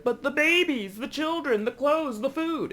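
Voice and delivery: high, frantic voice listing chores; panicking man. Retold or imitated. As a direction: flail through the list like a panicked parent. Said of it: "a man who's like".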